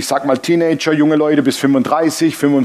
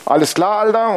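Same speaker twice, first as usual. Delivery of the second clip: cheeky devil voice; 5 semitones higher than usual. Acted. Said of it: "der Teufel kam zu Gott und sagt".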